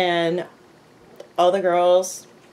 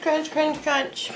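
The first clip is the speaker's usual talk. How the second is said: high, soft repeated crunch sounds; crunch crunch imitation; 9 semitones higher, 4 dB quieter, slower than usual. Shown as a demonstration. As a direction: mimic the crunching, lightly. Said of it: "That's all I can hear is crunch".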